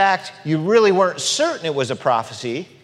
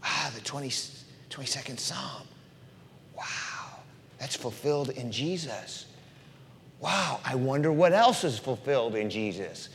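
hushed wonder; 8 dB quieter than usual. Retold or imitated. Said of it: "their mind would have gone".

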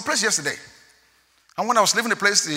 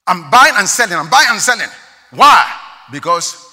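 preaching at full force; 5 semitones higher, 14 dB louder than usual. Acted.

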